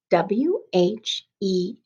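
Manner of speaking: slow letter-by-letter dictation; spelled out slowly. Shown as a demonstration. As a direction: spell it slowly for children writing along. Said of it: "You can write with me".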